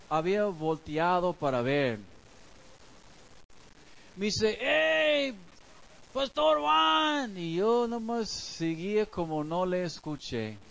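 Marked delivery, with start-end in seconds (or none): 4.6-7.4 a lazier, long drawn-out hail at his back; neighbor calling again. Retold or imitated. Neighbor tries again as the pastor ignores him, high and slow.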